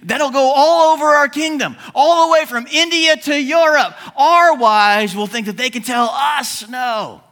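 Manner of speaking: high, loud mock panic; hammed-up distraught outburst, pitched high; distraught buddies. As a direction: raise the alarm as the king's panicked buddies. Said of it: "his buddies were distraught".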